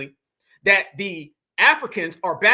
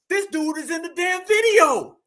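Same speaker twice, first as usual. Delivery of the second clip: high, incredulous shout; raised-pitch shout of outrage; exasperated outburst; 7 semitones higher than usual. Real, not acted. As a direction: burst out in indignant disbelief. Outburst at man in the video.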